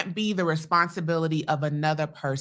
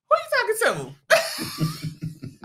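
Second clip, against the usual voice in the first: high laughing mock outrage; wife mocking her own sharp comeback, laughing high; indignant retort; slower than usual. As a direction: fire it back half-laughing, squeaky with disbelief.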